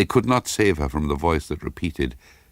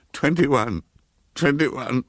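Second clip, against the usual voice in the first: higher, fading into a frightened whisper; frozen repeating; 10 semitones higher, slower than usual. Acted. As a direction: repeat it, stuck and losing breath. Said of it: "over and over again".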